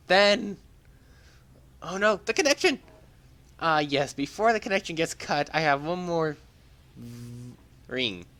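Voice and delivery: high, loud stammering in a rush; hurrying before the line cuts out; flustered young hero. Acted. Hero flustered as connection fades.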